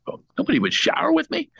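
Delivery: high, fast indignant disbelief; shocked. Retold or imitated. Comic reacting to the insulting intro, high and fast in disbelief. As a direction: squeak out the outrage.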